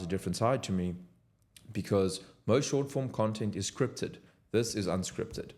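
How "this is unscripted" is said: ordinary delivery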